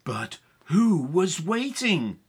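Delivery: high, loud, excited telling; dramatic story narration. A narrator's reading. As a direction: narrate the chase urgently. Narrates the chase.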